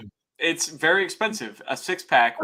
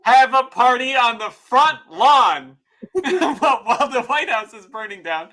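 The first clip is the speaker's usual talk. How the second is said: laughing while talking; 6 semitones higher, 5 dB louder than usual. Real, not acted. Laughing through the story.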